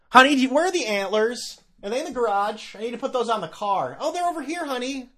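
high sing-song voice calling to a spouse; mock suburban parent. Mocking a festive car owner calling his honey, sing-song. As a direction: ham up a cheerful dad hunting for car antlers.

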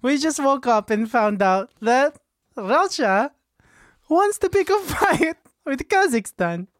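put-on high voice, laughter slipping in; secretary Sasha. Secretary reports war news to mediator, high-pitched voice. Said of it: "here's my secretary".

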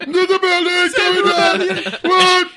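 high, loud frantic yelling; Forest Whitaker impression. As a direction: yell like a panicked zealot. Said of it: "Force Whitaker's going".

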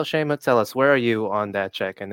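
ordinary delivery

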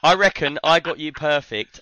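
ordinary delivery

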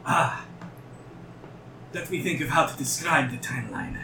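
a lightly voiced mad scientist; eccentric doctor voice. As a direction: lightly play the eager inventor. GM voices the Mind Flayer doctor.

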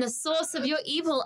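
Australian SOURCE, no hard R; Australian pronunciation. Shown as a demonstration. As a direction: say SOURCE just like Australian sauce. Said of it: "are exactly the same".

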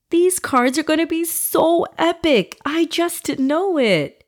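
put-on high, thrilled little boy; excited boy voice. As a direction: gush like a kid with new cards. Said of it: "beaming with excitement".

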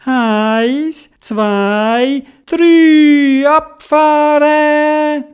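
high sing-out count, stretching the last word long; excited countdown. Acted. Sledders counting down to set off, excited rising call. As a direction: count off high, building to a loud drawn-out launch. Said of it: "Und sie haben zählt".

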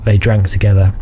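usual speaking voice

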